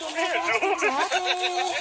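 high, loud laughing disbelief; real laughter. Real, not acted. Kid reacting to a try-not-to-laugh video, laughing. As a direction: crack up mid-sentence, voice jumping high. Cracking up at a funny clip.

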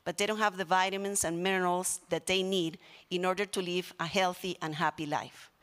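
ordinary talk

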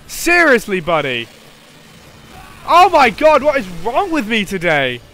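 loud, high frustrated outburst; exasperated yelling. Real, not acted. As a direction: yell at the monster, fed up. Frustrated at monster in fight.

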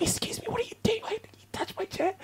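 hushed but sharp high confrontation; mock the mom's fierce challenge in a tight high voice; mom confronting. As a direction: mom telling off the man in the cinema, sharp and high. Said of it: "She was shouting at him".

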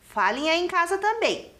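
ordinary talk

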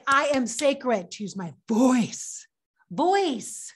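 slow declaration, VOICE punched; demonstrated stress on voice. Shown as a demonstration. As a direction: declare it slowly, land hard on voice. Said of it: "I want you to say".

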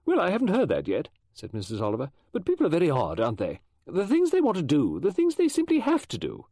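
high, lively gossiping woman voice; brisk chatty lady. Acted. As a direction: chatter on like an eccentric novelist. Mrs Oliver musing.

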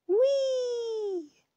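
very high, drawn-out whee; gleeful whee. Acted. Mouse rolling into the leaves, high gleeful squeal. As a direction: squeal it with pure delight.

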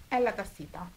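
normal talking voice

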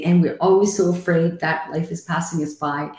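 ordinary talk

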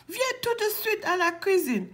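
high, rapid, scolding command; growled order. Acted. Papa ours orders Boucle d'Or to the kitchen, grumbling. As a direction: order it fast, a grumbling bear. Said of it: "en grognant".

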